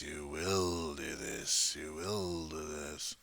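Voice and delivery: slow, hushed hypnotic suggestion; subtle command. Pretend. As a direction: slip the order in softly and slowly. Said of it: "some subtle commands".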